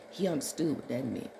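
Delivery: normal talking voice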